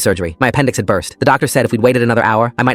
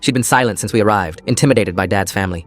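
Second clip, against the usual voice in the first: plain reading voice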